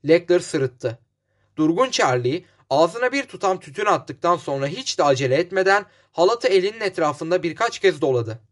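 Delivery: ordinary delivery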